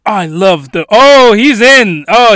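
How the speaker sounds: normal talking voice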